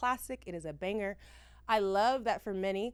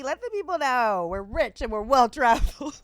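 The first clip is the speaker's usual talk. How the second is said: loud, showy brag; playful loud brag; 4 semitones higher, 11 dB louder than usual. Real, not acted. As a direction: announce it proudly to everyone. Bragging playfully about travel.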